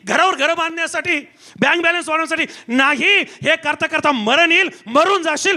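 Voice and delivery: relentless, building sermon cadence; impassioned preacher crying out to the congregation; full-force preaching. Acted. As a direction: pile up each phrase with rising urgency.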